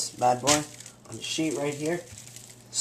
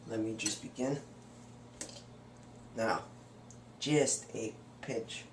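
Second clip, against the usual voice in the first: quiet; 4 semitones lower, 13 dB quieter than usual. Real, not acted. Quiet lead-in.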